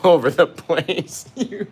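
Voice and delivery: loud, slowed words full of laughter; laughing. Real, not acted. Painter greeting viewers while laughing, words full of giggles. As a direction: talk through the giggles. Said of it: "that's hysterical".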